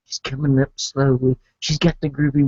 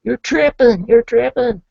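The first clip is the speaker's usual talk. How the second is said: insistent denial; 9 semitones higher, 4 dB louder than usual. Retold or imitated. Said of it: "I said".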